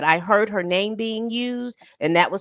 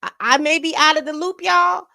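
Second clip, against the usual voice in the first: incredulous outburst; 7 semitones higher, 4 dB louder than usual. Real, not acted. Shocked by the text.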